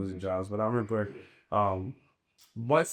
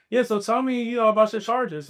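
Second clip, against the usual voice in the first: much higher, louder breezy supervisor voice; a hiring supervisor opening the hard question, voiced much higher and louder; imitating the supervisor; 11 semitones higher, 6 dB louder than usual. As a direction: play the boss casually asking, pitched way up. Supervisor asks about his charges.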